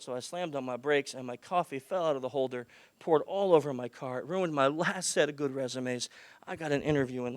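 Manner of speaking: soft, subdued retelling of a stressed applicant. Retold or imitated. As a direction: plead quietly, keeping the voice down. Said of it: "And I lowered my voice".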